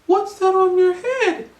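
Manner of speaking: high, slow put-on voice of the Japanese lady. Japanese lady asking the London guy about his hat, high and slow. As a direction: lift it way up and draw it out, curious. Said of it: "So she said".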